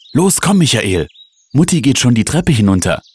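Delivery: high, eager calling; urgent call. A narrator's reading. Sister rushing brother after mother, calling high. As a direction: hurry him along excitedly. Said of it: "rief Steffi".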